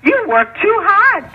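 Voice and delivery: high, drawn-out mimicry; high-pitched imitation of scolding advice; mocking retold advice. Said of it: "you know what they told her".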